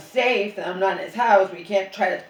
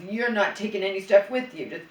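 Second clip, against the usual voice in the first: louder, mocking his power trip, rising to a yell; 2 semitones higher, 6 dB louder than usual. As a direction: mimic his gloating, then snap. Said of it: "no, it was".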